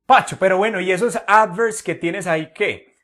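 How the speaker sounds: teacher voicing a student's question; student asking. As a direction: pipe up like an eager pupil with a question.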